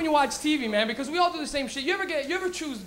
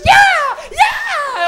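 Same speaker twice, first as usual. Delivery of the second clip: very high, loud cheer; excited kid shout; 14 dB louder than usual. Retold or imitated. Niece answering Dora on TV, shrieking excited cheer. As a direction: cheer at the TV like a thrilled little girl.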